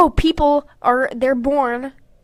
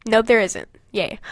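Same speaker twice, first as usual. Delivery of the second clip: ordinary talk, nothing marked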